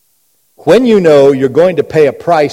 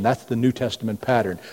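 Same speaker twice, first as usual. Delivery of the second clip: normal talking voice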